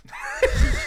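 high, loud burst of laughter; a shrieking cackle of real laughter. Real, not acted. Cracking up at the friends' joke, a shrieking laugh.

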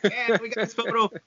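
high mock-gleeful group cheer; happy, grinning imitation. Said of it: "really grinning".

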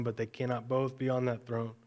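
ordinary delivery

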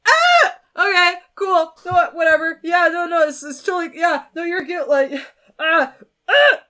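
high, loud flustered babble; hammed-up starstruck panic; freaking out. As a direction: fake being cool while panicking. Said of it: "a tiny bit freaking out".

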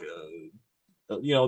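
ordinary delivery

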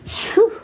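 high, breathy phew of amazement; impressed phew. Real, not acted. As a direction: let out a high, stunned blow of air. Reacts to her flirting.

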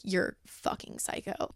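soft, slow breathy mock accusation; a half-whispered teasing dig; imitating the guy. The guy reacting to her claim, soft slow teasing dig. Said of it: "he'd be like".

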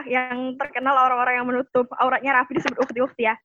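ordinary talk, nothing marked

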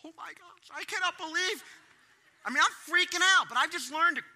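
high, loud panicked inner voice; hammed-up internal panic; mock alarm rising fast; inner freak-out. Shown as a demonstration. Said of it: "what I'm doing on the inside".

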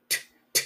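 T, t, t breathed out as bare unvoiced taps; whispered T sound. Shown as a demonstration. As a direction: hiss out the t, t like a sharp puff. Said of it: "La T, ¿verdad?".

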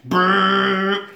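imitated motor brrr; drawn-out brrr of a speeding boat; motor noise. Film fan mimicking the dinghy racing to Nessie, motor noise.

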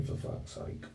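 whispered under the breath, voiceless; hushed exasperated aside to yourself; muttered inner curse. Retold or imitated. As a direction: swear privately, barely audible. Thinking to himself in dismay.

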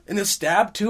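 normal talking voice

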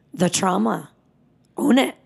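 ordinary delivery

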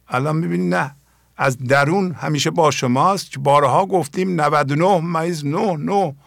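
ordinary talk

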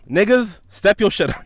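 loud, high playful challenge to the audience; mock call-out. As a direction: hype the listeners up in jest. No, I'm playing.

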